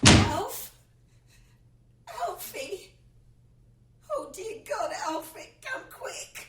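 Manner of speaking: high, shrill panicked cries for Alfie, voice cracking; frightened calling out. Acted. Wife discovers the hanged cat, calling her husband in panic. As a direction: call out in horror, then gasp the rest.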